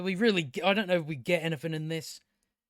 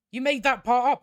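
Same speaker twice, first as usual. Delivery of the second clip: raised, higher, louder and insistent; louder insistent repeat; 6 semitones higher, 5 dB louder than usual. Retold or imitated. Retorting to the princess, exasperated and louder. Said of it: "repeated retort, louder".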